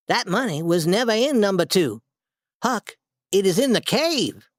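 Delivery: a hint of an eager boy; young Tom voice. Tom's excited reveal.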